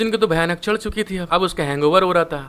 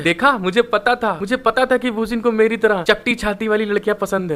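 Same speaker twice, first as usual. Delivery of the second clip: ordinary talk, nothing marked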